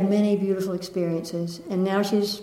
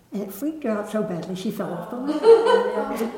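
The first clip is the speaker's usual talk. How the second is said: ordinary talk, nothing marked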